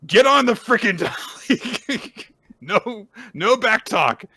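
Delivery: high, loud orders through laughter; bossy mock yell. Girl ordering a sick boy onto the dolly, bossy mock shout. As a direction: order it loudly, half cracking up.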